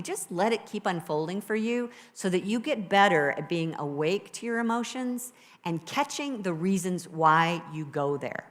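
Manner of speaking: normal talking voice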